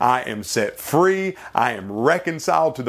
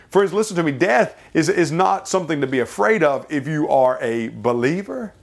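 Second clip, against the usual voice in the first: usual speaking voice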